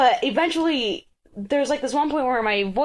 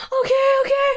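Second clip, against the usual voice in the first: panicky agreement; 4 dB louder, faster than usual. Retold or imitated. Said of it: "She's like".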